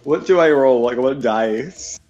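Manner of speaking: ordinary talk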